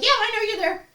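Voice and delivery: playful high pet voice; cat's voice. Pretend. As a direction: voice the demanding cat. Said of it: "she's like".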